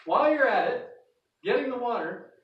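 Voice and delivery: loud, high call after her. Acted. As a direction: shout the extra request after her. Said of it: "Then he calls out and says".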